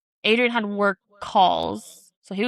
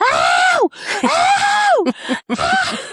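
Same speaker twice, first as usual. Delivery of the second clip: put-on very high, loud toddler yelps; shrieking ow ow ow; kid crying ow; 7 dB louder, much slower than usual. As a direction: yelp like a pinched toddler. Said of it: "then he's like".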